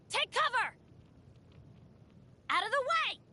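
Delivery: high-pitched urgent battle call; urgent warning. Acted. Adventurer warns teammates in battle, urgent call.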